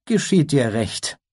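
a wry, half-amused reproach; wry unicorn. Acted.

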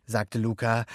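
usual speaking voice

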